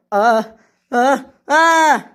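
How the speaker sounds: loud high grunts into a scream; climbing cries of alarm; falling yelps. Acted. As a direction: yelp twice, then scream as you drop. Engine dumped out, falling.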